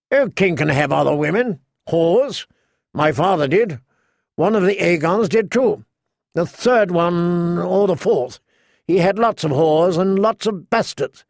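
preening, entitled character voice; Joffrey. Acted. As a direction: brag like a spoilt boy king. King Joffrey boasts to Sansa.

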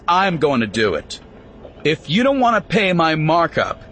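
stern warning. Acted. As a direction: lay down the law to everyone. Warning the crowd with rifle.

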